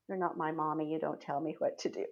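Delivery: lightly voiced defiant child, pitched low; defiant little girl. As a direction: imitate a little one refusing orders. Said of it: "she looked at me like".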